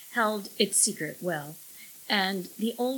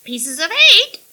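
high, loud squawking parrot; shrill parrot cry; 12 semitones higher, 15 dB louder than usual. A narrator's reading. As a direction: screech it like a parrot.

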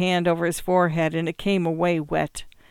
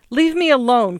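louder, sudden cry of refusal; 6 semitones higher, 7 dB louder than usual. A narrator's reading. Joe crying out at Gert on the phone before hanging up. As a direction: cry out to end it. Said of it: "cried Joe suddenly".

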